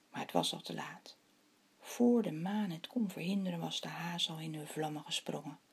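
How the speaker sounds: expressive storytelling with animated character lines; story read to children. A narrator's reading. Telling children the legend of the moon and the hares, storytelling. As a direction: read a legend aloud, unhurried with dramatic pauses.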